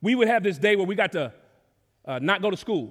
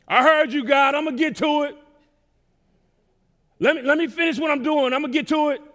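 higher-pitched, louder mock put-off; stalling reply; 6 semitones higher, 4 dB louder than usual. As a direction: brush God off in a raised voice. Acting out stalling reply to God.

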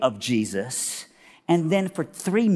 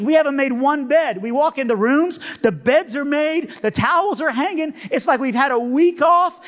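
high-pitched, gushing woman's voice; high, amazed put-on voice; dumbfounded cleaning lady; 11 semitones higher, 4 dB louder than usual. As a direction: imitate an overwhelmed hotel cleaner, high. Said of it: "We're all just dumbfounded".

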